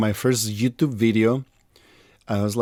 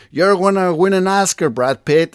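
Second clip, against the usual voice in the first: high, bright mock hairstylist; lightly voiced sketch hairstylist; 8 semitones higher, 4 dB louder than usual. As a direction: gush like a fake stylist.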